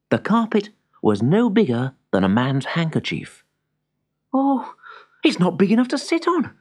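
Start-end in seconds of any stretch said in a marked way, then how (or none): 4.3-6.5 high, quick complaint; dismayed young wizard. A narrator's reading. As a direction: fret in a high voice, speaking fast. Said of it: "said Simeon".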